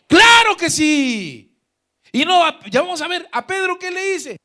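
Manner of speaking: full-voiced high preaching shout; emphatic shout. Real, not acted. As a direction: shout the answer out to the whole church. Preacher answers own question emphatically.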